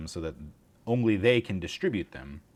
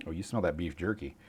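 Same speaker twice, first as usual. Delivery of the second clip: usual speaking voice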